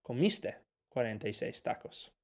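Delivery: quiet model line. Shown as a demonstration. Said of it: "voy a poner esta oración".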